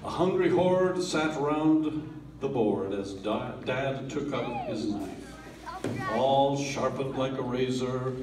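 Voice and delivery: plain reading voice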